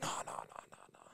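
whispered, trailing denial; zonked cellmate denying; mumbled denial. Retold or imitated. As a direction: mumble it barely there.

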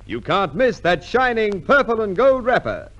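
ordinary talk

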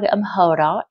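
ordinary delivery

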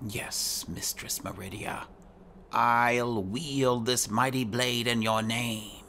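usual speaking voice

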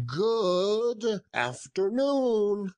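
high, slow curt dismissal; drawn-out high miser voice; curt old miser. Acted. Scrooge dismisses nephew again.

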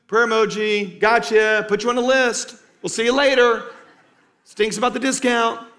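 high, loud, flippant send-off; mock breezy brush-off. Preacher mimics a glib reply to someone in need, mock-cheery and loud. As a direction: toss it off in a hammed-up cheery pitch.

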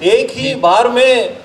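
measured, declaimed Urdu couplets with pauses; ghazal recitation. Acted. Poet presenting a ghazal to an appreciative gathering, declaimed recitation. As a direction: recite verse to a mushaira audience, line by line.

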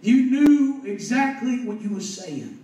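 high, loud, stretched-out exhortation; impassioned preaching. Real, not acted. Preacher reminds a man of his baptism, impassioned. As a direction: hold the key words long and ring them out.

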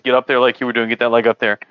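ordinary talk, nothing marked

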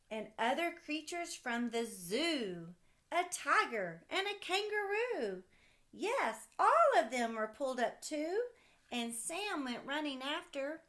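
put-on lively read-aloud voice for a child; storybook reading. Parent reading a kite story to a child, lively read-aloud. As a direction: read it like a bedtime picture book.

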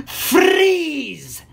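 high, drawn-out yell; long high-pitched villain cry; villainous shout. A narrator's reading. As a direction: shriek the command gleefully as a villain. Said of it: "he shouted".

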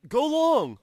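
high, drawn-out idiot call; mock idiot. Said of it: "like an idiot".

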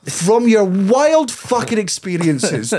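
high, laboured mock patience; sarcastic exasperation. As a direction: spell it out slowly for someone hopelessly slow. Acted exasperated exchange with waiter.

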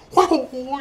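high, loud whoas; mock startled whoa. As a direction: jump up in a mock yelp. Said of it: "and you just go".

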